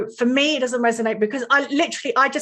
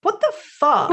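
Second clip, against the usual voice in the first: higher, incredulous retort; mock shocked retort; 7 semitones higher than usual. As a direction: react in disbelief, pitched up. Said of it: "I'm like".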